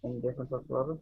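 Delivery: muffled, like under a bed; distant, smothered mic. Real, not acted. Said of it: "sounds like you're, like, underneath your bed".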